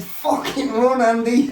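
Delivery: high desperate yell at his mate; urgent yell. Retold or imitated. Warning his mate again to flee, high desperate yell. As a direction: make him move, right now.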